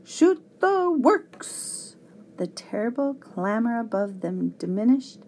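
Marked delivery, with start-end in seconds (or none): 0.0-2.0 high, drawn-out shriek; high-pitched squawking battle cry; feverish bird shriek. A narrator's reading. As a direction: shriek it slowly like an over-excited bird. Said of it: "shrieking feverishly".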